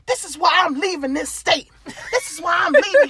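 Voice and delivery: exaggerated indignant man voice; mock indignant man. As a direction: play the offended suitor, high. Rejected man storms off.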